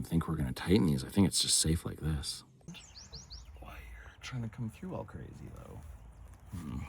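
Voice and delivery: soft unvoiced whisper. Real, not acted. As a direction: breathe the words as a private aside. Muttering aside while working.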